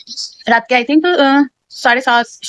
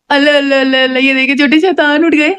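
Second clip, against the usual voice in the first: surprised cooing; 3 semitones higher than usual. Real, not acted. Baby wakes, mother coos.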